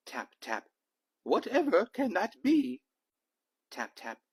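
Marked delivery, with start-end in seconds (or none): 1.2-2.8 put-on high, louder fussy old trustee voice; startled trustee. Trustee discovering the dog at inspection, high and fussy. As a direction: play a flustered old trustee peering out.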